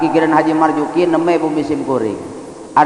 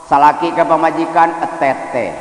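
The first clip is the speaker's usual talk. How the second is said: usual speaking voice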